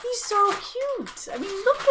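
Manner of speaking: cooing over cute. Real, not acted. Gushing over monkey label.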